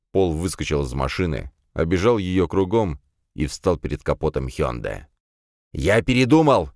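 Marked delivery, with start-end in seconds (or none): 5.7-6.7 shouted out of breath; breathless shout. Acted. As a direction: yell it, gasping. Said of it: "крикнул он, задыхаясь".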